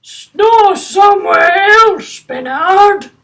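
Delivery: high, loud, slow grumpy fish voice. Acted. Grumpy Gills telling Bernard to stop snoring, high and loud. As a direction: grump it out, drawing each word out. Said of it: "he said".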